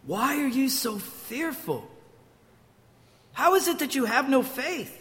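soft but high-pitched reproach; gently reproving, pitch lifted in disbelief; admonishing. Acted. Jesus turning to admonish fearful disciples, reproachful. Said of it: "he admonished them".

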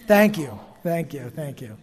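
half-hearted Southern accent on "thank you"; badly faked Southern drawl; attempted Southern accent. Shown as a demonstration. Preacher failing to pretend he is Southern, faint Southern accent. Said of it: "can't even pretend to have a Southern accent".

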